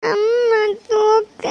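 high, long-drawn sobbing plea; crying. Acted. Child begs mother to lift her, crying. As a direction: wail to be picked up. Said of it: "எதுக்கு அழுறா?".

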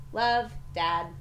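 ordinary delivery

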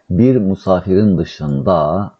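very slow, drawn-out word endings; teacher's slow, measured translation; slow dictation. Shown as a demonstration.

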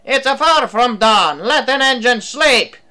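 loud, high bellowed complaint; angry bark. A narrator's reading. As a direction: shout it like someone robbed of sleep. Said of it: "he barked".

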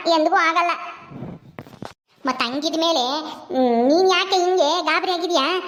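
mother voice. Acted. Plays the mother.

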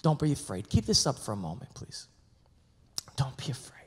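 a hint of a soft, low angel; angel's gentle voice. Angel calming the shepherds, softly and low. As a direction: quietly soothe like the angel. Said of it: "But the angel said to them".

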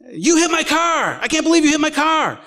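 high, hammed-up accusing shout; retold angry yell. Irate stranger to the preacher over his car, high mock-angry yell. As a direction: imitate an outraged young driver yelling. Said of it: "yell at you".

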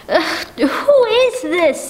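slow, crying question; tearful fear. Acted. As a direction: sob it out, barely holding together. Frightened woman on phone.

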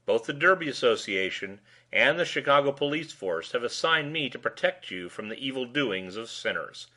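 ordinary delivery, nothing marked

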